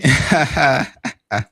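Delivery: loud delighted laugh; excited laugh. Real, not acted. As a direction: burst out laughing with joy. Excited reaction to big play.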